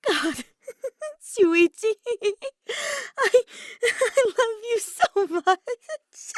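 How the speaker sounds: high, loud, laughing through the words; giddy, breathless laugh turning tearful, slow at the end. Acted. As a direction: gasp it out between laughs. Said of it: "attempting to say something in between his gasp".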